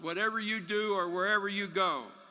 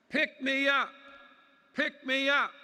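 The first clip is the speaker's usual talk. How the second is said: high, louder calling voice: "Pick me up"; mystery voice; 6 semitones higher, 3 dB louder than usual. Pretend. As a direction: call up from below like a hidden little creature. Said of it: "a voice says".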